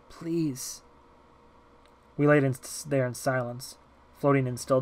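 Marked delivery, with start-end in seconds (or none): 0.1-0.8 soft, high pleading; hushed plea. Acted. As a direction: beg softly for silence. Girl begs for silence.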